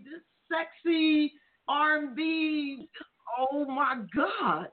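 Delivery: slow, drawn-out gushing over sexy R and B; drawn-out excited praise. Real, not acted. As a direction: savor each word, thrilled. Host gushing about her new music.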